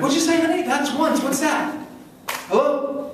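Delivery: high-pitched, baffled husband; high puzzled newlywed. Retold or imitated. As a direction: squeak out the confused questions. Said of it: "I'm like".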